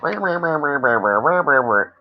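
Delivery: mock grumbling "rah-rah" babble; waiting-room grousing, mimicked; imitated crowd grumbling. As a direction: run nonsense syllables together like a complaining room. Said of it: "all these people are just bitching".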